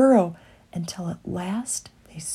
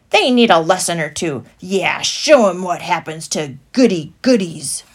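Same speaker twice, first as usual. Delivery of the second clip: fast, louder mob threat; threatening traders; 8 dB louder than usual. A narrator's reading. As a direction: egg each other on. Stallholders threatening.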